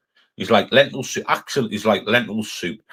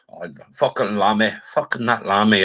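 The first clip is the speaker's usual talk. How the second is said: ordinary talk, nothing marked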